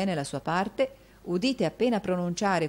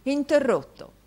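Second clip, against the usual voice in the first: theatrical exclaim; 8 semitones higher, 4 dB louder, slower than usual. A narrator's reading. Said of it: "esclamò il signor Mantalini".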